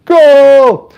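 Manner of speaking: very high, loud, held cry; soccer announcer goal shout. Acted. Streamer mimics the soccer guy after a touchdown, shouting. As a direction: belt out a long goal call. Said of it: "they need the soccer guy".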